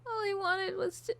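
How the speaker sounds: high, loud, tearful breakdown; sobbing, begging, voice breaking high; unhinged villain. Acted.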